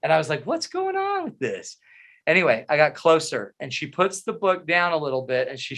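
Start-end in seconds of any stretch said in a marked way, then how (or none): 0.4-1.8 squeaky, high confused question; baffled high squeak. Retold or imitated. Husband baffled as wife hides behind book, high squeak. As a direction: pitch it way up in disbelief.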